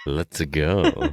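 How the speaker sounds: low, smooth sultry "Let's go"; sultry Mario voice. Retold or imitated. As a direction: imitate a sultry Japanese Mario. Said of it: "It sounds really sultry".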